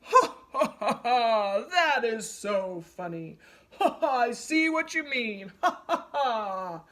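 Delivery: big laugh through the words; roaring laugh. A narrator's reading. Lion laughing at the tangled giraffe, roaring laughter. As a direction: laugh at him, loud and hearty. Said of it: "burst into a great roaring laugh".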